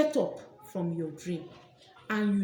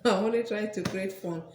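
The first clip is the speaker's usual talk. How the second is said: chuckling through the words; laughing; 3 semitones lower than usual. Real, not acted. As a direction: laugh while apologising. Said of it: "I'm laughing".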